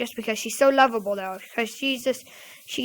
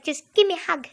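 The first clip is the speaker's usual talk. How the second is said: higher kid imitation, always the same; cousin's one-tone demand; 4 semitones higher than usual. Showing how cousin asks every time, pestering kid voice. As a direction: mimic a child's unvarying demand. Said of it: "No other tone".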